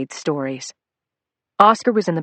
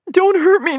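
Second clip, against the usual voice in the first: even higher, loud shrieking plea; terrified squeal; 7 dB louder than usual. Acted. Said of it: "he squealed".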